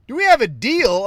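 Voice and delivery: mocking echo. A man mocking the chatbot's own question, sneering. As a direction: throw its words back in disbelief.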